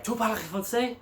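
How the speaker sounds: high-pitched mock French policeman; gruff officer's question. Policeman confronting a hitchhiker, high mock questioning. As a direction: demand it in a high, put-on French voice.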